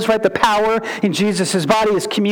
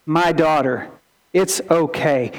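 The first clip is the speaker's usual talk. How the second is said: 1 tender; 6 semitones lower than usual. Retold or imitated. Jesus welcoming her publicly.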